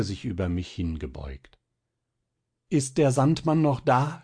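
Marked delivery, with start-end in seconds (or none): 2.7-4.2 higher, frightened stammer; boy waking in fear of the Sandman. Acted. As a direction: ask like a terrified child just waking. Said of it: "stammelte ich".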